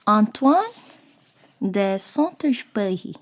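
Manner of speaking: amateur but relished French accent; French pronunciation. Shown as a demonstration. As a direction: savour the French name, pausing after the first name. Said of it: "I don't know any French".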